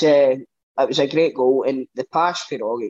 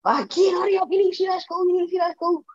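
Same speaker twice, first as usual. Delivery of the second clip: much higher, fast urgent call to the next room; calling out urgently; faster than usual. Retold or imitated. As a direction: hurry him back in, voice pitched way up. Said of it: "I'm shouting on him".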